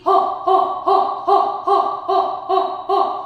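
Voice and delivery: loud rhythmic panting on ho; panting like a dog. Shown as a demonstration. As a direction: pant hard like a dog. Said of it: "So pant like a dog".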